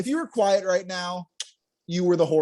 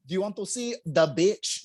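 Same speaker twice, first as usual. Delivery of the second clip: mom's Filipino accent, imitated; mock Filipino-accented question from a mother; thick Filipino accent. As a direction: repeat it in a put-on Filipino accent. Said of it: "She's got a thick Filipino accent".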